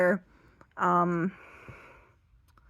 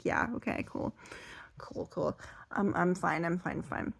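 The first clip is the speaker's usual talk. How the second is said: soft, muted reassurance; understated retold "it's fine"; downplaying it; 8 dB quieter than usual. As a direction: brush it off under your breath. Playing down the bite to the owner.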